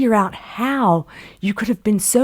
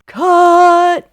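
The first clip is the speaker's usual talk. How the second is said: high, very loud director's call; shouted call; 12 semitones higher, 12 dB louder than usual. Retold or imitated. Director ending the scene out of nowhere, shouted. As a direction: bellow it across the set. Director calls cut on set.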